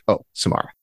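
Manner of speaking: breathy mock-creepy whisper of a name. Gushing over the actress, a mock-creepy whisper. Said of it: "Now I'm getting creepy".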